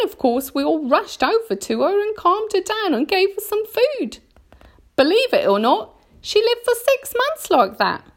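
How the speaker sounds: high-pitched, dramatic spider storyteller; Miss Spider. A narrator's reading. Miss Spider tells of her stuck grandmother, high and distressed. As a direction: relive the family tragedy in a high, emphatic voice.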